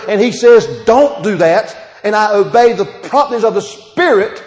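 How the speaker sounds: loud, high revival preaching; fiery preaching. Acted. Preacher exhorting the congregation, loud and high. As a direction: preach it full force to the church.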